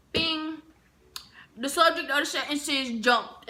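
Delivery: hammed-up cocky student; cocky answer. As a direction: proudly announce the wrong answer. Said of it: "I said".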